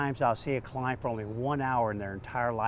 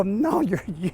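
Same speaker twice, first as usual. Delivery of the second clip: flustered reply; 7 semitones higher, 10 dB louder than usual. Retold or imitated. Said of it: "I go".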